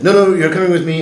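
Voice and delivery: high, loud insistence; insistent order. Retold or imitated. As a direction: drag him along with words. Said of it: "and he said".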